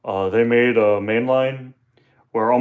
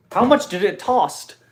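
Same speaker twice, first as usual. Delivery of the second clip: high, mocking echo of the flub "tossed"; teasing repeat of "tossed"; mock repeat of own slip; 8 semitones higher than usual. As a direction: parrot your own mistake back, amused. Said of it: "did I just say how much is it tossed".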